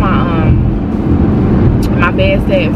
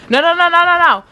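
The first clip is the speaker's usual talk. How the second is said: high urgent string of no; high panicked protest as he rips the flowers; pleading no; 8 semitones higher than usual. Real, not acted. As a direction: protest fast and high to stop him. Stopping him ripping flowers.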